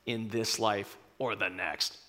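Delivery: low, slow, menacing whisper-growl; Maximus vengeance. Retold or imitated. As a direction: swear revenge deep and quiet. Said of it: "he says".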